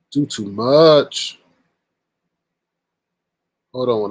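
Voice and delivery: usual speaking voice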